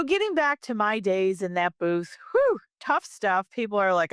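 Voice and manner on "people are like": ordinary talk, nothing marked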